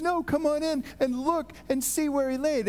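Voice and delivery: high-pitched, coaxing acted voice; angel's eager invitation. Angel inviting the frightened women inside, eager coaxing. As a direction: urge them inside, pitched well up.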